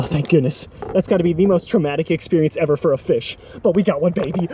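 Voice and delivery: ordinary talk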